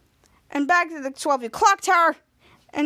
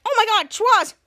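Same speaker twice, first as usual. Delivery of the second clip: higher, louder cry of alarm; alarmed exclamation; 5 semitones higher, 5 dB louder than usual. Retold or imitated. Ray exclaims to Schwartz, acted alarm.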